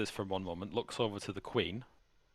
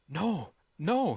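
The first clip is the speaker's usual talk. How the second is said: high-pitched, louder horrified protest; horrified denial; 10 semitones higher, 5 dB louder than usual. Acted. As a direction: deny it in horror, voice shooting up. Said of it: "He looks horrified".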